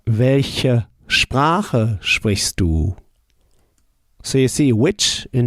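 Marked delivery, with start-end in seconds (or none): 0.1-3.0 very slow "Welche Sprache sprichst du?"; deliberately slowed German; slow model answer. Shown as a demonstration. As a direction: lay each word out for the learner. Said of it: "How would you say".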